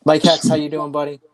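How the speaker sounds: upbeat host shout-out; excited shout-out. Real, not acted. Shout-out to a chat viewer.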